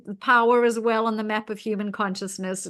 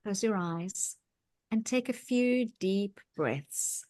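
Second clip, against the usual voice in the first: soft, quiet settling-in voice; guided meditation; 6 dB quieter than usual. Acted. Host opening the meditation for listeners, soft and calm. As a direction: ease the listeners into stillness.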